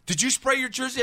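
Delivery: a coach catching a player in the act; coach questioning. Retold or imitated. Coach voiced in retold story.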